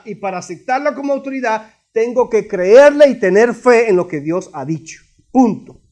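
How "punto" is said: usual speaking voice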